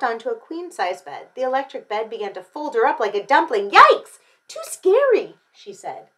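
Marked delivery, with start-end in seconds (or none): 3.7-5.4 very high, loud yelp; storybook scare; startled fright. A narrator's reading. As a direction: cry out in sudden alarm. Bed folds Goldie up.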